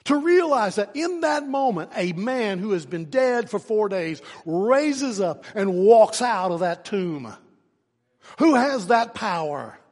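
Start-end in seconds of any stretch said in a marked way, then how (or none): none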